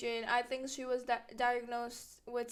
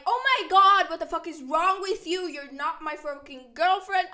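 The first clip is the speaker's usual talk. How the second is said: loud, higher outburst of rage; furious yell; 4 semitones higher, 8 dB louder than usual. Retold or imitated. [name] going off on Ashley at the party, yelling furiously. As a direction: blow up at her at full volume. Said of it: "I go off on Ashley".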